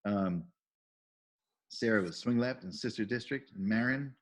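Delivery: ordinary talk, nothing marked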